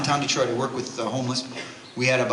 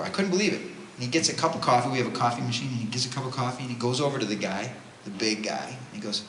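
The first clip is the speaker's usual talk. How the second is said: ordinary talk, nothing marked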